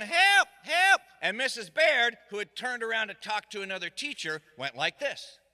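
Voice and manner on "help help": put-on high, loud kid's cries for help; yelling for help. Kids faking danger to make the teacher notice, loud high shouts. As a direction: call out to the teacher in mock panic. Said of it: "we started yelling".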